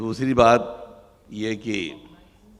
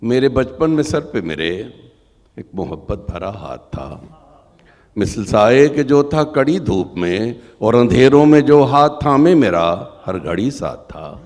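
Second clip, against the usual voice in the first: loud declaiming poem voice; stately nazm recitation; 3 semitones higher, 13 dB louder than usual. Acted. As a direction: recite with rising emotion.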